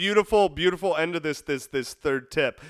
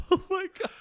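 shocked squeal; faster than usual. Real, not acted. Reacting to theatrical request.

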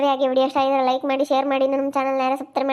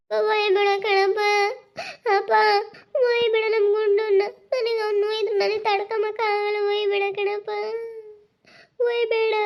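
put-on pleading child; 8 semitones higher than usual. Child begs father to leave Gunda, high pleading wail.

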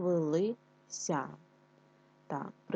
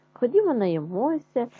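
higher, louder, eager fox urging; eager invitation; 5 semitones higher, 8 dB louder than usual. A narrator's reading. Fox urges hedgehog into the orchard, eager and loud. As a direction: pull him along with greed.

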